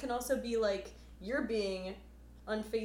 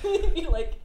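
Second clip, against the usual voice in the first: laughing; 9 semitones higher, 14 dB louder than usual. Retold or imitated. Laughing mock reaction.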